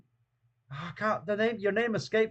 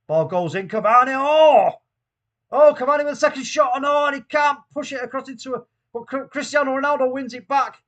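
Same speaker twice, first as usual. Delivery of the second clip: higher and louder, calling the attack as it happens; excited play-by-play; 7 semitones higher, 9 dB louder than usual. Real, not acted. Streamer calling his team's attack, excited play-by-play. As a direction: get swept up in the chance. Live commentary on a chance.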